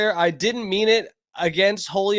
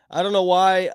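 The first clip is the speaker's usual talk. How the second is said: ordinary talk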